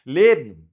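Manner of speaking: ليبن loud with the ending as m; merged ending. Shown as a demonstration. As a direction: hit ليبن short, "lebm". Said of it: "اضغمها كأنها إم". (نطق ليبن وآخرها كأنه ميم)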